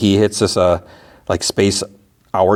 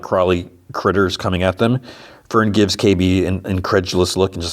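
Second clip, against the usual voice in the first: usual speaking voice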